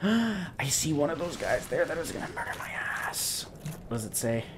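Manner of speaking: 1 nervous whisper. Real, not acted. Spotting dangerous enemy.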